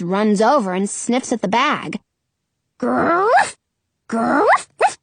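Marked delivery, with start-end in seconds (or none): 2.8-5.0 playful barking grrrf; dog growl. Acted. Dog sniffing the bag, mock growling. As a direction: growl like a curious pup.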